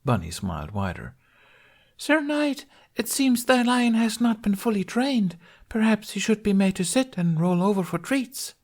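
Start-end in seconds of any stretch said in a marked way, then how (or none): 2.0-8.5 very high falsetto taunt; mocking damsel. A narrator's reading. Bunny as Lunette mocks the knight's lion, high and taunting. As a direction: needle him in a piping stage voice.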